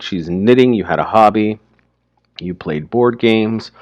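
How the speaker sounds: usual speaking voice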